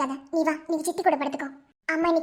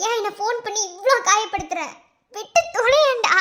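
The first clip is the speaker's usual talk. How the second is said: angry outburst; 4 semitones higher, 4 dB louder than usual. Acted. Woman on the phone tells him to leave her, furious shout.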